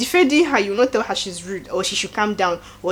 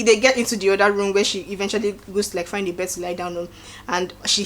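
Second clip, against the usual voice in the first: ordinary talk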